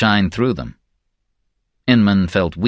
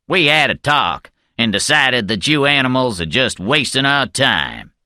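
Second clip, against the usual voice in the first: higher-pitched character voice for a guard leader; scornful guard, put-on voice; contemptuous guard leader; 4 semitones higher than usual. A narrator's reading. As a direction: voice a guard leader fed up with his prisoners.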